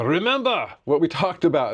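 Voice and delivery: put-on amateurish stab at a Scottish accent; horrible Scottish accent. Imitating the coach's advice to trainees, badly faked Scottish accent. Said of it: "that's a horrible Scottish".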